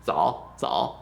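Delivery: "Zao" low and dipping; teacher modelling a low, short "zao"; simple word demo. Shown as a demonstration. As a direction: sink low on a lone "zao". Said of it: "they'll just say this".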